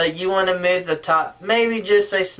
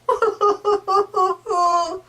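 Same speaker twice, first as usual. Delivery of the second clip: mock sad wail; 12 semitones higher than usual. Shown as a demonstration. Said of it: "Do you want them to be sad".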